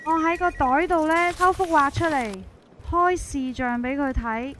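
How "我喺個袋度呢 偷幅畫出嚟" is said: ordinary delivery, nothing marked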